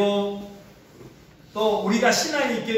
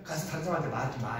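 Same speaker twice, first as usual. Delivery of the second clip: quick, soft, hushed instruction; low hushed aside, hurried; 작게 서둘러 당부하듯; hushed warning; 7 semitones lower, 8 dB quieter, faster than usual. Retold or imitated. Jesus telling disciples to keep silent.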